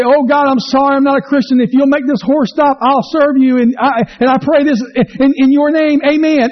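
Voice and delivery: desperate prayer. Retold or imitated. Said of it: "the guy begins to pray".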